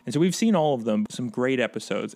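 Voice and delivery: ordinary talk, nothing marked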